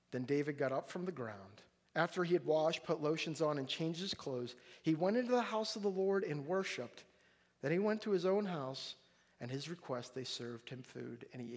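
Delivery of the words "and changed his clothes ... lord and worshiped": normal talking voice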